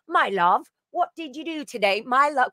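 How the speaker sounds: high, fond English endearment; doting British. Retold or imitated. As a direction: coo it like an English charmer. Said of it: "'cause my love is so dearing".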